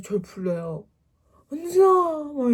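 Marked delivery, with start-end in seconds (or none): 1.5-2.5 mock wail, high and loud; tearful call. Sister crying out for her younger sister, mock tearful wail. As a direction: call out in an exaggerated sobbing wail. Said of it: "이렇게 울면서".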